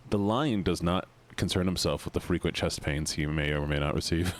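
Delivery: low, solemn lion proclamation; grave deep narrator voice. Pretend.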